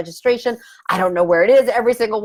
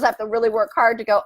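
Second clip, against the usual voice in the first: usual speaking voice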